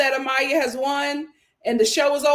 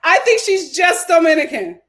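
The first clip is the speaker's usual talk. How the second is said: louder, higher, hammering each word; emphatic amused insistence; 6 semitones higher, 7 dB louder than usual. Real, not acted. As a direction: repeat it loudly after a laugh, insisting. Repeats her point after laughing.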